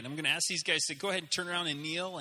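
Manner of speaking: ordinary delivery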